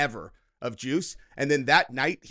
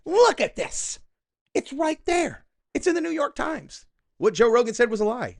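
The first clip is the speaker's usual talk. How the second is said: mock quote; 9 semitones higher, 4 dB louder than usual. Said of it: "she's all".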